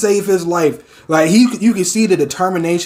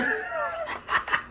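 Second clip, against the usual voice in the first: breathy laugh; 15 dB quieter than usual. Real, not acted. Laughing at Shigaraki.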